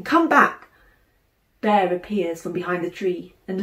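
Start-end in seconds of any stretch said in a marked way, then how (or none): 0.0-0.7 loud, sharp shout of alarm; proper shout. A narrator's reading. Sister shouting at her brother not to hide, loud. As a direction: yell to stop him vanishing. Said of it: "properly shouting".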